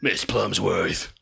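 slow, drawn-out monster call; dragon monster voice. Pretend. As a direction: meant as superhero, comes out as dragon. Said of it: "You did like a dragon monster voice".

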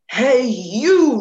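loud, booming call; loud commanding old lion call; roar. Acted. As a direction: roar it out at the young one. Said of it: "roared the lion".

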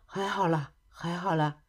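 soft, low-key brushing off; sheepish, softened soothing; meek reassurance. A narrator's reading. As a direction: play it down quietly. Meek reassurance after a scare.

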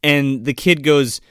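normal talking voice

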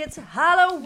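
loud, high celebratory shout; excited cheer. Acted. Podcast host announcing Halloween to listeners, excited shout. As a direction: whoop it up for the holiday.

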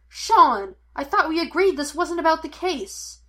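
loud, high, protesting shout; calling after someone. A narrator's reading. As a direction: call him back in frustration. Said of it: "Henry called after him".

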